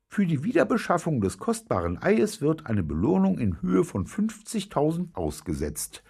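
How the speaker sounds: high-pitched female announcer voice; TV newsreader. A narrator's reading. Newsreader announces the reward for the egg, high announcer voice. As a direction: read it out high, as a news bulletin. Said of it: "sagte die Sprecherin".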